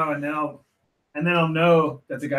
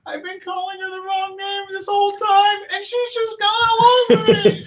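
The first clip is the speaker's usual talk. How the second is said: imitating a woman, much higher and louder, overwhelmed; mock hysterical laughing; 13 semitones higher, 3 dB louder than usual. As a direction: retell her disbelieving near-shriek, hammed up. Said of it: "she's laughing hysterically, and she's like".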